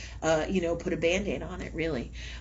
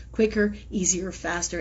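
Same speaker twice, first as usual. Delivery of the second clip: ordinary talk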